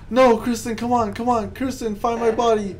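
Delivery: pleading. Real, not acted. Pleading teammate to find body.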